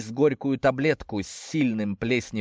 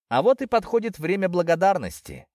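sarcastic; 8 semitones higher than usual. A narrator's reading. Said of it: "не сдержал сарказма я".

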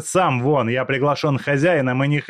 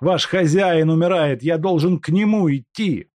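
desperate plea; 9 semitones higher, 7 dB louder than usual. Acted. Степанов связан, кричит.

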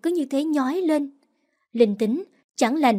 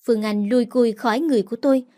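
plain reading voice

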